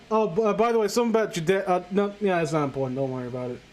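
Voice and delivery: ordinary talk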